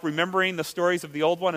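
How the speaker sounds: ordinary talk, nothing marked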